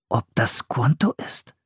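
whispered thought to oneself; pondering. A narrator's reading. Boy wondering who the man is, whispered pondering. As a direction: wonder under your breath. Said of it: "überlegte Philipp".

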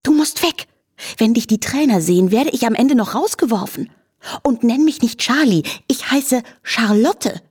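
a hint of an indignant horse brushing off the flea; snorting horse Charlotte. A narrator's reading. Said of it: "schnaubte sie".

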